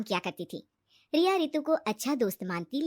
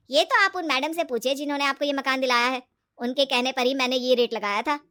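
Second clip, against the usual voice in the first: loud, self-justifying dealer; defensive property dealer; 6 dB louder than usual. Acted. Dealer blaming the madam for the rate, defensive. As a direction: push the blame away, loudly.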